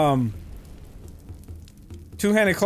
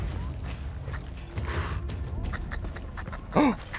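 sharp gasp; 3 semitones higher, 11 dB quieter than usual. Real, not acted. Wolves attack.

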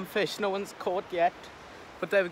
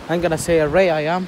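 calling out; 10 dB louder than usual. Real, not acted. Calling out to friend.

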